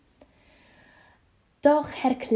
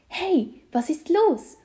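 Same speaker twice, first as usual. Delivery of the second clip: higher, drawn-out puzzled call; taken-aback question; surprised; 7 semitones higher, 4 dB louder than usual. A narrator's reading. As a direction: call out in slow astonishment. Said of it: "wundert sich Felix".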